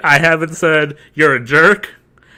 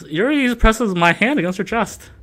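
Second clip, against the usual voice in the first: ordinary delivery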